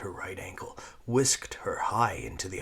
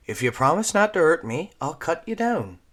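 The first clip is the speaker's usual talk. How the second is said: put-on high, loud young girl bargaining; cheeky girl; 9 semitones higher, 11 dB louder than usual. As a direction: bold little girl making a deal. Voicing Squeak.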